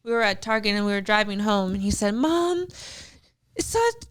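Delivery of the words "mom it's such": higher-pitched, imitated plaintive child; sad little boy. Son complaining from the back seat, sad little-boy voice. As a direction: act out an upset young son. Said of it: "looking really sad".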